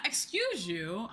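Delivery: ordinary talk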